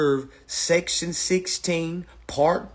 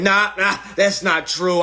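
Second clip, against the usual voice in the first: mock know-it-all; 7 semitones higher, 6 dB louder than usual. Mocking know-it-all newcomer.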